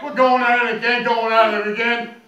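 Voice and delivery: usual speaking voice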